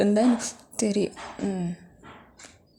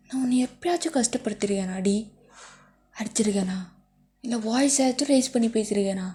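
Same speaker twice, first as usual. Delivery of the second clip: ordinary talk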